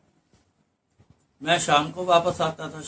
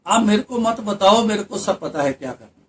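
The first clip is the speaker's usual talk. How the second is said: irritated retort; 5 semitones higher, 7 dB louder than usual. Retold or imitated. Worker rebuffs narrator's instructions.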